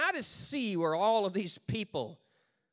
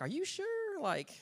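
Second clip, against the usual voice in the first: doubtful writers; 6 semitones higher, 5 dB quieter than usual. Retold or imitated. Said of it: "And we're like".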